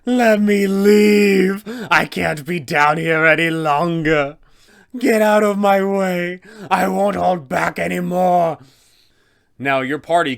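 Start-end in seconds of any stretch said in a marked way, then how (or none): 0.0-8.6 high, loud frantic shriek; screaming vampire. Acted. Vampire spawn runs past the party screaming, frantic loud shriek. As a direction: shove past everyone, desperate to flee. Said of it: "screaming such things as".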